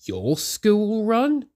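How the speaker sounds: high, slow puzzled question; quizzing. A narrator's reading. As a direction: ask in disbelief. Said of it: "quizzed Toby".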